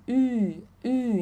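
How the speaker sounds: "Ü" as an I through rounded lips; pouted Ü. Shown as a demonstration. Teacher showing the lip position, "Ü" as pouted I. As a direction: pout and say I for "Ü". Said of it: "fazer um biquinho e falar I".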